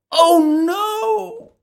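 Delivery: high, loud dismay; Alice panicking; alarmed cry. Acted. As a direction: gasp it as you start to grow. Alice grows again.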